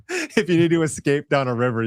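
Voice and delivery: chuckling through the words, pitch up; laughing. Real, not acted. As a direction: laugh while you say it. Joking about fleeing by river.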